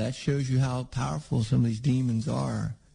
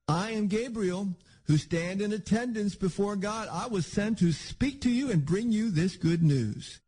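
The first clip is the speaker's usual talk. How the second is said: higher, imposing rebuke, slowing at the end; stern archangel; 6 semitones higher than usual. Retold or imitated. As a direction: speak as a towering archangel. Gabriel rebukes Zechariah, scripture.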